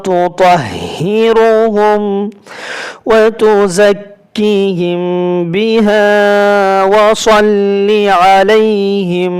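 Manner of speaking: slow, melodic tajweed recitation; Quran recitation. Acted. Teacher recites the full verse to students, slow and melodic. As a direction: chant the verse slowly with long held notes.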